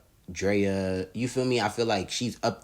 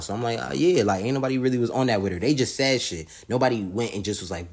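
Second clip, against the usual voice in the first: usual speaking voice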